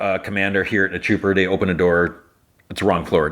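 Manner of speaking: usual speaking voice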